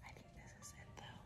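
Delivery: soft whisper in a quiet room; library whisper. Real, not acted. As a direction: whisper so nobody nearby hears. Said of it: "Whispering 'cause I know people can hear me".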